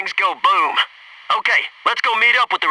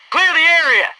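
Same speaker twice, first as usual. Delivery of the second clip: urgent call; 9 semitones higher than usual. Acted. Charge about to blow.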